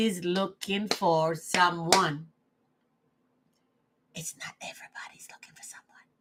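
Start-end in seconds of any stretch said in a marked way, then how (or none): 4.1-6.1 fast, breathy whispered repeat. Shown as a demonstration. Repeating her point to herself, whispered. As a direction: whisper it to yourself, quickly.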